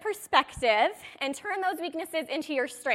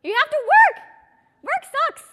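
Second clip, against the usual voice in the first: much higher and louder mock whine; whiny complainer; 12 semitones higher, 10 dB louder than usual. Coach voices a lazy seller's complaint, mock whining. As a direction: play a lazy seller moaning about work.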